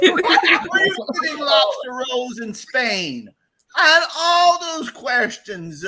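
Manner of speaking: high, slow, laughing outrage; exasperated disbelief. Acted. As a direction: stretch it out in disbelief.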